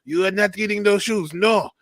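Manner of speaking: put-on a light Haitian accent, stern refusal; lightly voiced Haitian father saying no; Haitian dad accent. Haitian accent, friend's father refuses Junior the shoes. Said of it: "would be like".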